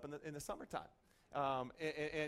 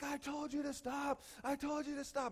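high, strained scolding at normal volume; retold high-pitched tirade; mock yelling; 8 semitones higher than usual. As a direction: imitate an angry man yelling, held back. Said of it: "he's yelling at her".

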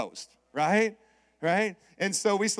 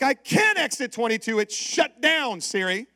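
raised, higher-pitched exasperation; retold yell at the GPS, slow on "shut down"; yelling at Siri; 4 semitones higher, 3 dB louder than usual. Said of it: "when you start yelling at Siri".

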